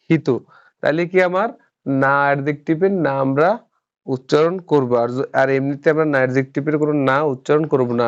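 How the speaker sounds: ordinary talk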